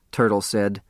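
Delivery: ordinary delivery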